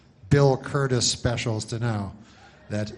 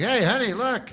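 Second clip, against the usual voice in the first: mock excited viewer; 9 semitones higher than usual. Viewer calling to spouse.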